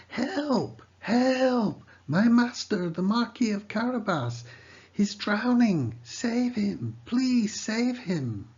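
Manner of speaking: high, loud cry for help; desperate shout. A narrator's reading. Cat shouting to passers-by for help. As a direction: yell for help at the top of the voice. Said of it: "Puss shouted at the top of his voice".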